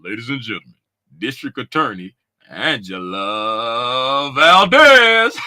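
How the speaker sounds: low, slow announcer introduction; mock announcer. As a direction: announce her like a grand entrance. Announces viewer arrival.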